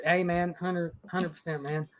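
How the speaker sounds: ordinary talk, nothing marked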